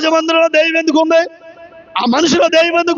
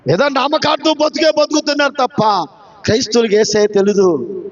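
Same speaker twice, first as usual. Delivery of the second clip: ordinary delivery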